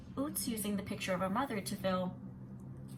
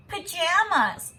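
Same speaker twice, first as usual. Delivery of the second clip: loud, slow jeering "Pajamas!" pitched high; shouted taunt; 9 semitones higher, 11 dB louder, much slower than usual. Acted. Boy mocking her clothes, loud slow jeer. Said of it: "he shouted".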